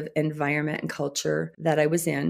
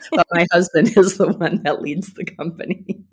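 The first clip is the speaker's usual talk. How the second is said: real laugh breaking into the words, louder; 5 dB louder than usual. Real, not acted. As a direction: talk through your own laughter. Laughing at her own joke.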